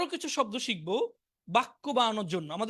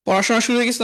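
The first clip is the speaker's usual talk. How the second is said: louder, calling the class to attention; loud announcement; 6 dB louder than usual. Real, not acted. Teacher loudly announcing that studying has started. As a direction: boom it out so everyone hears. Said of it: "আমি sound প্রচন্ড বাড়িয়ে দিয়েছি".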